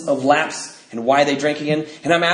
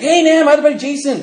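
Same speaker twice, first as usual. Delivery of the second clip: eager buddy voice; 11 semitones higher, 5 dB louder than usual. Retold or imitated. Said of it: "He's like".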